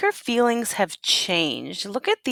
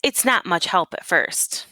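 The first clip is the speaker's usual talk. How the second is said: story narration. Acted. Teacher reads picture book aloud.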